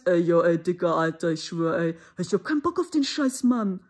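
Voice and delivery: German street slang. Retold or imitated. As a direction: swagger like a bored German gangster teen. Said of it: "You hear them talking sometimes".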